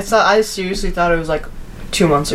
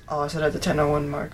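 ordinary delivery

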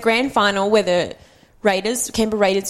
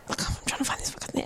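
fast, breathy whispered snap; hurried whispered mum, searching her bag; hushed irritated mum; 12 dB quieter, much faster than usual. Retold or imitated. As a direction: hiss it at him, annoyed and busy. Mum snapping while searching bag.

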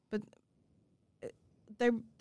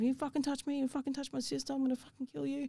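retold rage; 7 semitones higher, 3 dB quieter, faster than usual. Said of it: "I went off".

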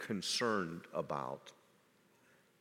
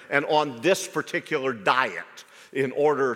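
loud, high preaching mocking the ads; preaching at full force; 6 semitones higher, 7 dB louder than usual. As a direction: rail against worldly adverts from the pulpit. Preacher mocks worldly ads.

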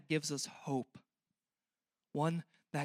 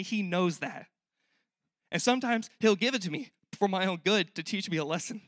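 louder, higher preaching build; preaching with force; 4 semitones higher, 5 dB louder than usual. Acted. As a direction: lift it like a preacher pressing a point.

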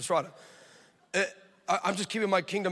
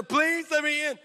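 high, drawn-out mock begging; desperate plea; 9 semitones higher, 3 dB louder, slower than usual. Crying woman pleads at the locked door, desperate. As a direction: imitate a woman begging to get in.

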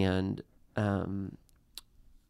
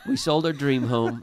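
higher, louder pulpit delivery, punching sold; mock preacher; 4 semitones higher, 5 dB louder than usual. Husband repeats his line for the teasing host, hammed-up preacher. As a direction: lean into the sermon, owning the joke. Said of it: "You preacher, you".

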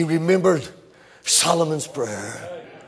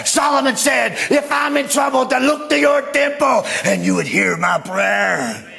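desperate shouted prayer; 6 semitones higher than usual. Acted. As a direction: beg God from the whale's belly. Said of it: "He began to pray out to God".

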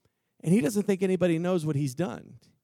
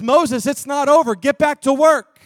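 louder firm command; commanding God; 4 semitones higher, 7 dB louder than usual. Acted. God at the burning bush, loud firm command. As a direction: order him back to work.

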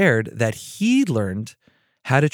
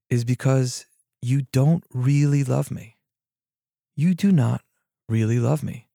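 normal talking voice

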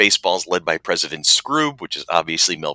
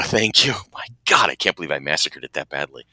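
ordinary talk

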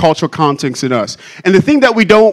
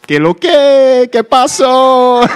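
slow, higher Spanish punchline voice; 6 semitones higher, much slower than usual. Acted. Preacher delivering the joke's punchline as Jesus in Spanish. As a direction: drawl it out as a Dominican-sounding Jesus, pitched up. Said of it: "and he goes".

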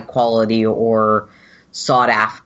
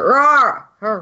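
high creature roar, pitched up; Wookiee roar; 10 semitones higher than usual. Acted. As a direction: roar out like a Wookiee calling over. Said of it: "you will hear sort of a".

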